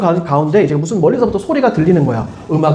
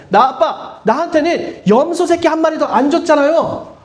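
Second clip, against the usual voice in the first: higher-pitched mock whine at a parent; whiny complaint; 7 semitones higher than usual. Older brother complains to dad about the goat, whining. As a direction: act out a sulking son's grievance. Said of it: "그렇게 투정을 하는데".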